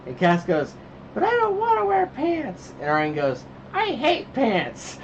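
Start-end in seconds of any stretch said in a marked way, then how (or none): 1.1-2.7 high, drawn-out imitation of a protesting kid; mock a child refusing, pitched way up; whiny child protest. Dad imitating his kid refusing pants at bedtime, high child voice. 3.7-5.0 put-on high, very slow kid voice; child's mock protest. Dad imitating his son refusing pants, high drawn-out protest.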